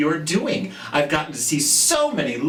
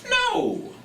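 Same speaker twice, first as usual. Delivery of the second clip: a high squeaky mouse cry of no; nervous squeak. Acted. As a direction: squeak it like a scared little mouse. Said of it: "Montmorency squeaked nervously".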